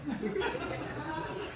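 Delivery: a quiet, hammed-up gasp of offence; mock offended gasp. A lost tourist gasps at the stranger's insult, mock offended. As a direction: gasp as if insulted, half laughing.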